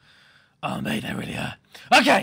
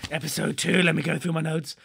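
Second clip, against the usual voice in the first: normal talking voice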